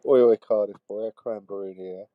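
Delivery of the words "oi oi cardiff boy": loud cheery oi oi; bellowed mate greeting. Acted. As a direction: call out a hearty hello.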